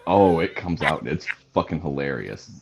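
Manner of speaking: ordinary delivery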